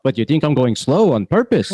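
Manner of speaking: put-on Yiddish-flavored New York accent.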